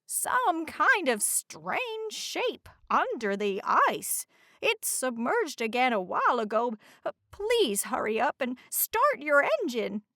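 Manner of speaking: the watchful lighthouse reporting something strange; Lighthouse Marjanimi. Acted.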